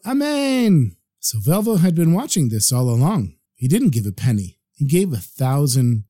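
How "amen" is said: high-pitched, drawn-out amen; shouted amen. A narrator's reading. Woman answering the rabbi's prayer for children, shouted amen. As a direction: call it out high without much added volume. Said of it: "the woman would shout".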